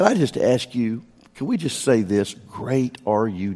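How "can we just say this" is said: normal talking voice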